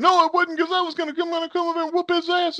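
very high-pitched protesting voice; mock indignant denial. She insists it wasn't Dustin, mock indignant. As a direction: imitate a worked-up relative insisting.

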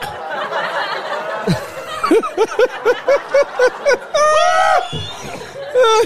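high, explosive laughing fit. Real, not acted. Friend cracks up at the fridge line, howling laugh. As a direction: burst out laughing at the joke.

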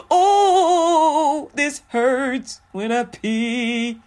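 high, loud oh, then slow whiny complaint; mock pained man. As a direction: whine like a man who just found it hurts. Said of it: "'Cause they go".

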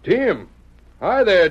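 loud call across the yard to a distant ranch hand. Acted. Sheriff calling Tim from across the ranch yard, loud call. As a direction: shout his name to get him over here.

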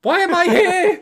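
a lost little fighter asking why; confused Foss voice. Pretend. Voicing helpless Foss.

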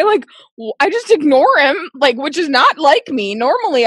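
ordinary talk, nothing marked

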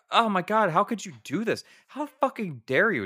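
high, loud hammed-up indignation; shrieking mock fury at him; mock hysterical outrage. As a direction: play it as an over-the-top B-movie meltdown. Acting out hysterical actress.

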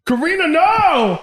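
loud, slow alarmed shout. Real, not acted. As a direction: yell a real warning. Friend overdoing the hot sauce.

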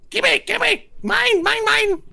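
very high, loud goblin squeal; greedy goblin. Acted. Goblin grabbing for the gift, greedy high-pitched squeal. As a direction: squeak it out in frantic little bursts. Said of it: "then a goblin ... runs out, and goes".

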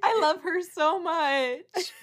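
high, slow, drawn-out gush; adoring squeal. Real, not acted. Co-host gushing over a favourite islander, adoring squeal. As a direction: gush as you come out of laughing. Gushing fan after laughing fit.

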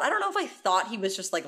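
usual speaking voice

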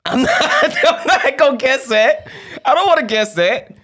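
high giggly refusal; laughing. Real, not acted. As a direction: laugh through the words.

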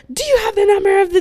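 high, loud, rushed demand; rapid-fire mock cop shout; imitated yelling. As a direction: cut in with a yelled question. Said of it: "yelling them at him".

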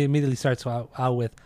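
normal talking voice

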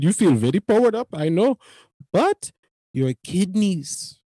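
imitated Indian accent, slowing at the end. Clerk warning a customer about kidneys, Indian-accented caution. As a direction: warn in a put-on Indian accent, drawing out the last words.

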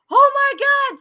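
high, loud retold gasp of disbelief; retold shock. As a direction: replay the moment the news hit. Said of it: "And I'm like".

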